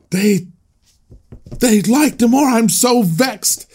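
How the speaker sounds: high, slow whiny pout of a sulking emperor; petulant emperor. Retold or imitated. Teacher mocking the Gladiator emperor, high and whiny. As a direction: whine it like a spoiled ruler who lost the crowd. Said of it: "and he's like".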